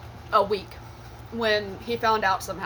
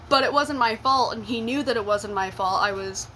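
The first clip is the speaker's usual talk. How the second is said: normal talking voice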